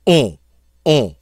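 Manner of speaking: short nasal "on" with no N; "On" through the nose, cut crisp; nasal vowel. Shown as a demonstration. Teacher drilling French sound, nasal vowel. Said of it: "That's the sound we're looking for".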